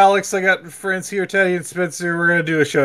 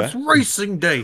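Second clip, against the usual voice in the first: put-on Cockney accent; 7 semitones higher than usual. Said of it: "doing a little Cockney accent".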